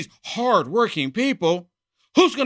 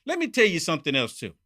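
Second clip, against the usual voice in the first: ordinary delivery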